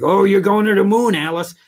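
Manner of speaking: Ralph Kramden bluster. Retold or imitated. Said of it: "he used to always do things like".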